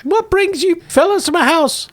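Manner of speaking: very high housewife voice; Gamorrean woman. Acted. Woman asking visitors their business, high-pitched. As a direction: question the visitors in a high character voice. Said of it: "A middle-aged Gamorrean woman".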